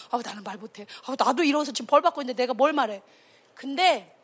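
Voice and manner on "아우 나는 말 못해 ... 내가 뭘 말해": low, hurried self-excusing; softly acted reluctance; timid excuse. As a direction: wriggle out of it under your breath. Voicing people unwilling to confront.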